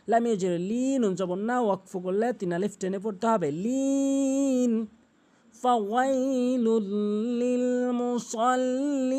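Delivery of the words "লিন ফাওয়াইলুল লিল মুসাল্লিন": higher, very slow sustained chant; Quran recitation. Shown as a demonstration. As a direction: hold each long vowel melodically. Recites the verse. (উঁচু স্বরে, লম্বা টেনে তিলাওয়াত)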